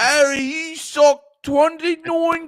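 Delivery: put-on silly British accent. Mock author tallies Harry's feat, silly British accent. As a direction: declaim it in broad mock-English. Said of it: "slipping out of the accent".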